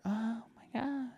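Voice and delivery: very high, whispery pop-star impression; mock a tiny, breathy high voice; slow, hushed and sky-high, mocking. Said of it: "she had to go back to her".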